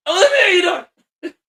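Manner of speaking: loud, high pubescent squeal in one burst; voice cracking. Acted. Boy obeys the father's order to speak up, cracked shout. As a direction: blurt it out at full volume and let the voice break. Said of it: "my voice is going in and out".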